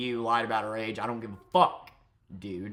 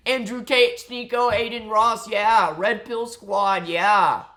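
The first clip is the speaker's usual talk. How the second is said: high, loud hype shout; over-the-top squad chant; mock cheering; 9 semitones higher, 10 dB louder, slower than usual. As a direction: holler names like a rowdy fanboy.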